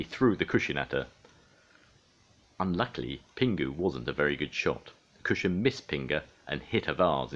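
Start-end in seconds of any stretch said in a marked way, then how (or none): none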